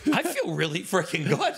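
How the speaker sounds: high, loud and thrilled; elated. Retold or imitated. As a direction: burst out feeling great. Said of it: "you're like".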